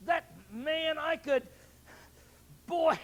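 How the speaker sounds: loud, high, furious sputtering at another driver; shouting mad behind the wheel, words choking off; road rage fury. Retold or imitated. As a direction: boil over at the man who cut you off. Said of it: "I'm talking about".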